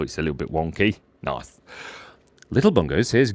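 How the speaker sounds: ordinary delivery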